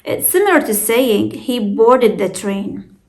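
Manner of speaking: usual speaking voice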